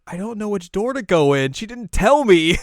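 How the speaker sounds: high, loud flustered wail; mock panic. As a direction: wail it, stretching the last words. Acted panicked complaint.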